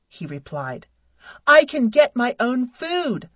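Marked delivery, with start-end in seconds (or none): 1.5-3.3 loud, high yell. Acted. Wife yelling at husband who served her plate, loud. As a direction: snap it out at full volume. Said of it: "I yelled".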